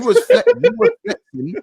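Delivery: high mocking squeak; mock his bragging, way up high.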